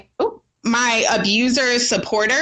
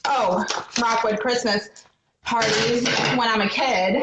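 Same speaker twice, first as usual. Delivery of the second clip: ordinary delivery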